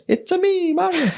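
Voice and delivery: high squeaky Italian plumber; cartoon Italian plumber voice; Mario voice. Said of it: "that voice wasn't necessarily Charles".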